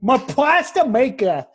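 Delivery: high, slow hammed-up Boston accent. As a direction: exaggerate the Boston vowels in pasta. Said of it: "you teleported from Hawaii to Boston there".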